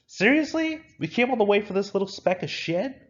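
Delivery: very high, loud, incredulous complaint; high-pitched indignant griping; indignant disbelief. Acted. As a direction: squeak it out in outraged disbelief. Character indignant at tiny find.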